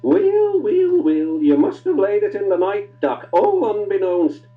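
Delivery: high, loud Scottish teasing; Scottish accent. Acted. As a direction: tease him in broad Scots. Donald teases Duck, Scottish.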